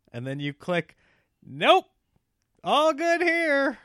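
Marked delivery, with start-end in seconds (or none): 1.5-3.8 loud, high sing-out of false reassurance; breezy all-clear, pitched way up; chirpy denial. Acted. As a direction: brightly insist everything is fine, drawing it out. Fake-cheerful click on health question.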